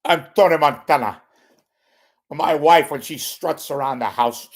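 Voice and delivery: loud, high-pitched Tony Montana impression; Scarface. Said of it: "What if Scarface was a stand-up comic?".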